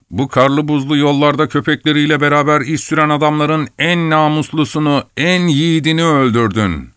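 high-pitched, mildly angry accusation; understated indignant reproach; accusing. A narrator's reading. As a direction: lay the blame on him, pitch held high. Acted accusation of Yan.

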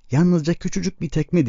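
high-pitched, wheedling plea; pleading. A narrator's reading. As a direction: beg for a small favour, voice lifted high. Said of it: "diye yalvardı Joel".

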